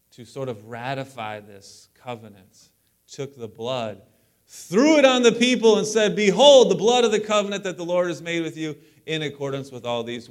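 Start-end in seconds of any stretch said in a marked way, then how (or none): 4.5-10.3 sudden booming proclamation. Acted. Moses throwing blood on the people, loud proclamation. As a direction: burst out loud and high, then ease down.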